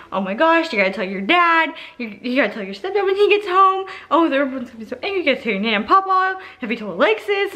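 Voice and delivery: high, rushed string of worries; panicked mom. Retold or imitated. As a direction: imitate a flustered mom piling on demands. Mom's panicked rattle of demands.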